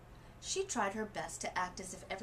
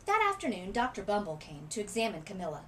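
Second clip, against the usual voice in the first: ordinary delivery, nothing marked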